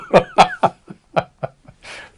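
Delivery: loud high burst of laughter; hearty laugh. Real, not acted. Doctor laughs at his own warning, loud and high.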